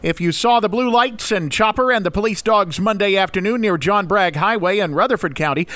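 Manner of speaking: ordinary delivery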